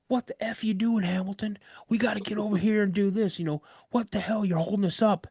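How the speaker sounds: mock sergeant chewing out a recruit; angry drill instructor. Instructor scolding Hamilton for holding up the squad, gruff and impatient.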